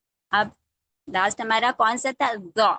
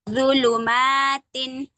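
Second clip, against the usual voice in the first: ज़ुलुमातिन read very slowly; slow recitation; 3 semitones higher, 3 dB louder, much slower than usual. Shown as a demonstration. Teacher modelling a hard word for students. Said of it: "कोई word अगर इसमें कैसे".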